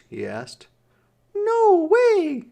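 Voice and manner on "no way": put-on very high, loud little girl; little sister voice. A narrator's reading. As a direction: squeak it like a small stubborn girl. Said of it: "she said".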